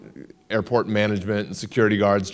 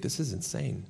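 much softer, turned inward; inner worried thought; 9 dB quieter than usual. Retold or imitated. Alone in the sun outside the meeting, softly doubting to himself. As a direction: say it under your breath, doubting yourself. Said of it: "And I would think".

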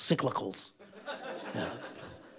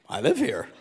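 deadpan; 9 semitones lower, 3 dB quieter than usual. Retold or imitated. Husband's deadpan answer to wife.

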